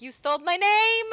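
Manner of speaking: high, loud mock voice for the dog; dog's voice. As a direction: voice the sulking dog playfully. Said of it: "He's like".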